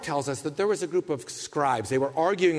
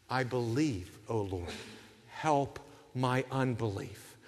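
much softer, lower, slow prayer; quiet confession; 6 semitones lower, 9 dB quieter, slower than usual. Retold or imitated. Believer confessing doubt to the Lord, soft and low. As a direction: murmur it like a private prayer. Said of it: "John Calvin says".

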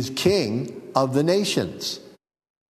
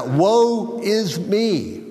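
very slow, higher Woe is me; drawn-out lament; 6 semitones higher, much slower than usual. Shown as a demonstration. Preacher resuming Isaiah's cry after a joke, drawn out. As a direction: let it sink, slow and high. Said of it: "but yeah".

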